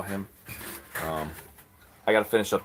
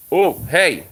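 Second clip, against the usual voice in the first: sudden loud, higher "hey!" to stop an animal; surprised call; 6 semitones higher, 8 dB louder than usual. Real, not acted. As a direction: snap it out, caught off guard.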